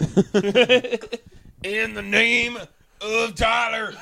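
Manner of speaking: high, very slow, drawn-out invocation; mock proclamation. Host invoking a friend's name, mock proclamation. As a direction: proclaim it grandly, stretching each word.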